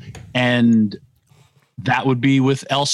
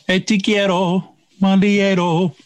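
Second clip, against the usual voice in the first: higher-pitched, put-on Latin accent; 7 semitones higher than usual. Host playfully attempts a song he liked, mock Latin voice. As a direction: put on your best Latin voice for a song lyric. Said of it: "sing it in my best Latin voice".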